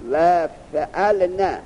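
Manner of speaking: slow, stretched-out recitation of فعلن; drawn-out reading. Shown as a demonstration. Teacher reads the next pattern فعلن slowly for the student.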